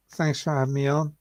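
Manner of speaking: ordinary talk, nothing marked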